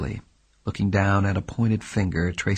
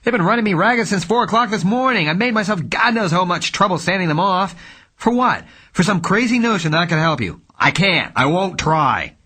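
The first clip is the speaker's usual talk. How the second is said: detective furious at his client; loud with rage; 10 semitones higher, 5 dB louder than usual. Acted. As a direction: explode in anger. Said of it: "his voice loud with rage".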